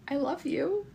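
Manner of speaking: high, soft, smitten little voice; melted adoration. Retold or imitated. Babysitter melting on the floor at a toddler, adoring coo. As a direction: coo it softly, high and tiny.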